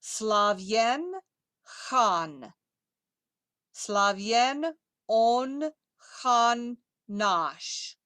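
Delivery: very slow, drawn-out Russian words; slow pronunciation model. Shown as a demonstration. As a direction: space out each word for repetition. Models a Russian line for learners.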